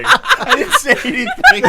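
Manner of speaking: high, loud, drawn-out squeal of laughter; helpless laughing denial. Real, not acted. Player denies saying a thing, helpless laughing protest. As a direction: protest while cracking up completely.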